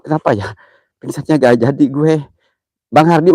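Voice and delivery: normal talking voice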